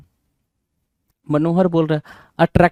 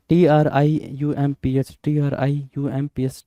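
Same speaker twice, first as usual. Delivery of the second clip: spelled letter by letter; slower than usual. Shown as a demonstration. Teacher spelling out a word for students, slow and letter by letter. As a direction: slowly spell out each letter. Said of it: "Triumph".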